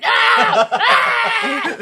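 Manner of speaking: loud, high scream; mock yell. Calling out a silly dog name, loud high scream. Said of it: "shouting a dog name".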